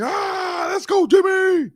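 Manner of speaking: excited scream. Retold or imitated. Fan cheering his player's touchdown, screaming at full voice. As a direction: scream it like a touchdown just happened. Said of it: "yelling, screaming".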